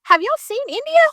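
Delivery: high-pitched incredulous jab; mocking disbelief. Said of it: "It's like".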